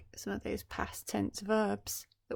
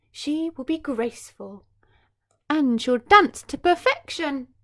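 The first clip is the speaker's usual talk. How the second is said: higher and louder fairy wish; 6 semitones higher, 10 dB louder than usual. Acted. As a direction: sing out the blessing. Fairy gives her wish.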